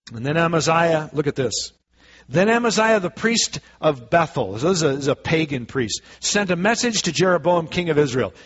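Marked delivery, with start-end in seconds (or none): none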